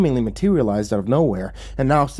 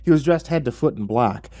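usual speaking voice

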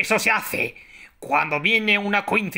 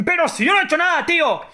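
indignant protest; 9 semitones higher, 3 dB louder, much faster than usual. Pretend. Protests innocence in role-play.

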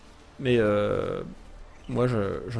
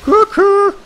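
very high, loud cartoon greeting; cheery squeaky coucou; sing-song hello; 7 dB louder than usual. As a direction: call out playfully. Greeting new island.

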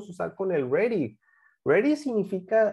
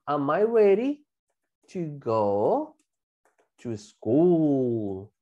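"Am I ready", pause, "to go", pause, "to school"; piece-by-piece modelling; 4 semitones lower, much slower than usual. Shown as a demonstration. Teacher adds more information for class, slow and segmented. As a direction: stretch out each chunk with pauses between.

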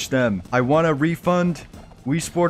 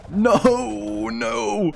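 panicked no; 9 semitones higher than usual. Real, not acted. Sword swinging out of control.

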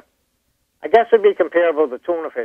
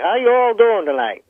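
higher-pitched mock Southern greeting; imitated Southern accent; 7 semitones higher than usual. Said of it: "that's the stuff down south".

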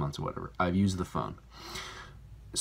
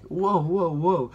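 high, loud startled whoa; surprised; 9 semitones higher, 5 dB louder, slower than usual. Retold or imitated. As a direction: recoil in mock alarm. Retold shock at dislikes.

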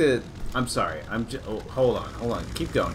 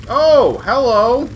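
loud exaggerated greeting; 12 semitones higher, 12 dB louder, much slower than usual. Greeting a passer-by loudly.

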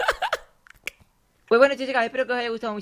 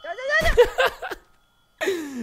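laughing; much slower than usual. Real, not acted. Laughing agreement.

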